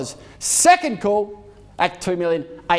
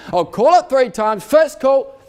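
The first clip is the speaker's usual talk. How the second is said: calling out; 5 semitones higher, 7 dB louder than usual. Real, not acted. Auctioneer calling first call.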